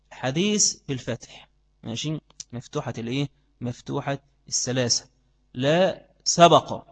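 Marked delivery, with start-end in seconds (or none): 5.5-6.8 slow, drawn-out "لا سبق" with the b voiced as open "sabaq"; sabaq with open vowels. Shown as a demonstration. Teacher to students, drawing out "لا سبق" as sa-ba-q. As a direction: spell out "سبق" slowly as sa-ba-q. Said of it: "السين مفتوحة، الباء مفتوحة".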